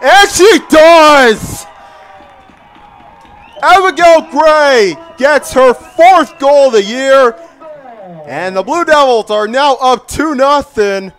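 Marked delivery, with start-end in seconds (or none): 0.0-7.3 loud goal call, stretched; excited goal call. Real, not acted. Penalty goal scored.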